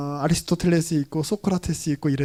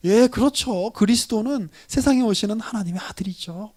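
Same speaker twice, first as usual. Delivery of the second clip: ordinary delivery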